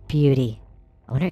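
normal talking voice

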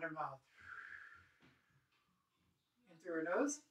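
soft, unhurried breathing cues with long pauses; guided breathing. Acted. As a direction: calmly lead the class through each breath. Leads slow breathing exercise.

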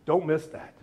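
ordinary talk, nothing marked

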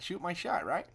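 ordinary delivery

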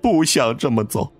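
high, tearful lament to a dead wife; crying while speaking. A narrator's reading. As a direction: grieve aloud through tears. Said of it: "一边哭一边说".

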